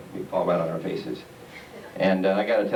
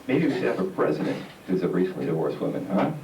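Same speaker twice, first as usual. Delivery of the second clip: ordinary talk, nothing marked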